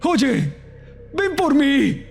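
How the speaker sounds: high-pitched yell, no extra volume; desperate shout. Acted. Brother challenging the man at the back, shouting high. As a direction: call out in strained desperation. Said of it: "solo pude gritar".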